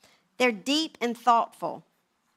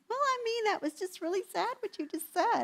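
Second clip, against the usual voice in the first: very high, small tearful voice; mock teary whimper, hammed up; imitated weepy voice; 11 semitones higher, 4 dB quieter than usual. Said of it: "Are you crying?".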